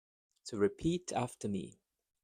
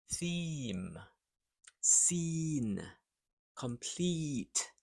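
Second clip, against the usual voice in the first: usual speaking voice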